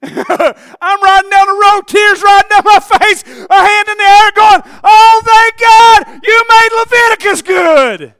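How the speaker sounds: high, full-force drawn-out holler; preacher reliving a shouted hallelujah; ecstatic praise shout. Retold or imitated. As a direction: cry out praise at the top of your voice. Reliving tearful praise while driving.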